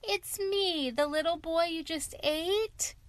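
put-on high little-boy call; small voice piping up, pitched high. A narrator's reading. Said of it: "he called".

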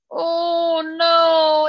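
retold moan of dismay; grieved reaction. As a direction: stretch it out in dismay. Retells her grief reaction.

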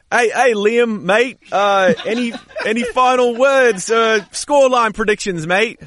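high, loud, hammed-up fake British accent. Co-host fakes an accent to ask Liam for predictions, hammed-up British. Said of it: "Well, let's hear it. Fake it".

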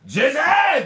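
put-on a kid sneaking up yelling; kid's playful shout. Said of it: "って言った".

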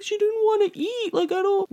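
high, flustered stepdad imitation; imitated helpless excuse; defensive stepdad. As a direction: pitch it up like someone making excuses. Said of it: "And he's like".

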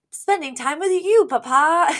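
very high mock little-boy voice; young Maxwell. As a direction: imitate a child pleading with Papa, high. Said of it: "little Mister Sheffield goes".

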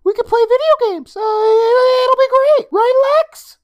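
put-on high, loud boyish voice in a panic; panicky boy. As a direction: scramble for an excuse, frantic kid voice. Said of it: "really in a panic now".

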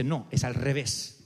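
low, slow correction; lightly voiced God. God answers slowly.

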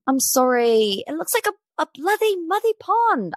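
high-pitched, hammed-up British accent; badly faked British accent, drifting Irish with a lisp. Shown as a demonstration. Podcast host retrying a celebrity's pond complaint, hammed-up British accent. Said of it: "Let me get my best [name] on".

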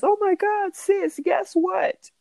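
high, gushing excited voice; excited squeal. Retold or imitated. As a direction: squeal it like big news. Paris excited to her sister.

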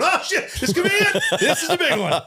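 high laughing mock panic; panicked pilot. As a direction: squeal it like a pilot losing it. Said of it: "imagine a pilot going".

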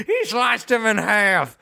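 high, slow wrestling announcer wail; mock-horrified ringside call; J R impression. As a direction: cry it out like an aghast commentator. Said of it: "what's that J R is that wrestler announcer's name".

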